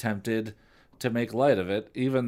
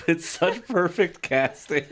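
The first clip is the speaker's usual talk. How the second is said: laughing; 4 semitones higher, slower than usual. Real, not acted. Laughing while praising casting.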